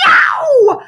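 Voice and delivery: high loud yelp of pain; a high yow of pain. A narrator's reading. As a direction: cry out in pain. Kids hit by drumstick.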